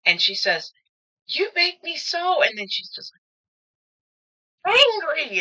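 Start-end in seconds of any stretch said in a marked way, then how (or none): 1.3-2.4 much higher, squeaky mock-angry voice; Amy Adams in Enchanted. Amy Adams to the lawyer, squeaky, mock-furious and much higher. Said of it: "she just starts giggling". 4.6-5.4 much higher, squeaky mock-angry voice; Amy Adams in Enchanted. Amy Adams to the lawyer, squeaky, mock-furious and much higher. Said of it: "she just starts giggling".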